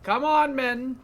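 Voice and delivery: high, loud mock battle cry; rally the troops, hammed up. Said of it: "And it's like".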